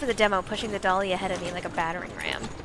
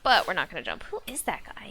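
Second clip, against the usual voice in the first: ordinary talk, nothing marked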